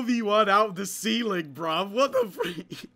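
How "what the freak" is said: high surprised. Real, not acted. Surprised by ambush.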